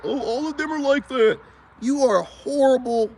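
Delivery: high mocking whine; mocking women. Vlogger mimicking women who pick bad men, high whine. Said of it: "and then be like".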